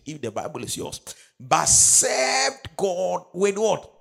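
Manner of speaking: high, loud scripture proclamation; preacher building in force, drawing out the verse; full-force preaching. Real, not acted. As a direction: hold the key words long and loud.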